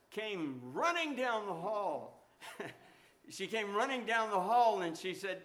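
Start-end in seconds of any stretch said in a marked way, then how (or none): none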